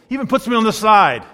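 normal talking voice

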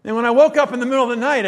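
ordinary talk, nothing marked

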